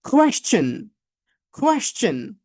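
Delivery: "কোয়েশ্চেন" with aspirated K and clear "শ্চ"; model pronunciation. Shown as a demonstration. Teacher models an often mispronounced word, model pronunciation. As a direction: drill "কোয়েশ্চেন" ending in a ch sound. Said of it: "পরের ওয়ার্ডটার উচ্চারণটা সবারই কিন্তু কম বেশি ভুল হয়".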